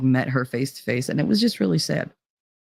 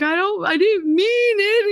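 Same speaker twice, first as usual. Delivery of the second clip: high, loud, frightened plea; boy begging the angry father; scared kid; 4 dB louder than usual. Retold or imitated. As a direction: beg like a terrified boy. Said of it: "He was like".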